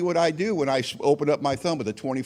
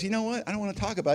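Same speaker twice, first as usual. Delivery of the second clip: higher-pitched mock wife refusal; imitating annoyed wife; 7 semitones higher, much faster than usual. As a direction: play a wife brushing off the question. Retold wife, refusing to talk.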